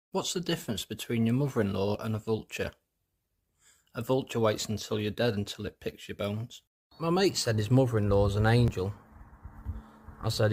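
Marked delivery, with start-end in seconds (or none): none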